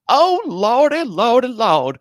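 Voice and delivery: high, loud, caricatured wail. Retold or imitated. Host mimicking how the film's characters talk, high hammed-up impression. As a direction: wail it out as an exaggerated old-movie caricature. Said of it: "like, that kind of, uh, impression".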